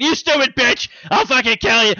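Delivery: high, loud mock screaming rage; imitating Chucky yelling. Friends recapping the movie, hammed-up doll fury. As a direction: spit it out like a furious killer doll. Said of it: "some of his lines where he's just like".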